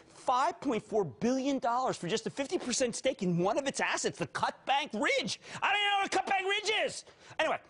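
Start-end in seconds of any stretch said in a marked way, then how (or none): 5.6-7.0 high, rushed aside; exasperated outburst. Real, not acted. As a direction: blurt it out fast and high. Host's exasperated aside in rant.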